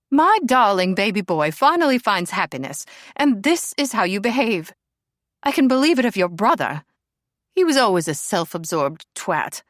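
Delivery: loud, grand mock indignation; theatrical indignant lady. A narrator's reading. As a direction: play the outraged aristocratic mother. Lady Helena scolds the princess.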